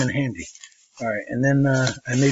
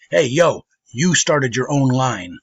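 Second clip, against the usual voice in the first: retold callout; 3 semitones higher, 7 dB louder, slower than usual. Man in line calls her out.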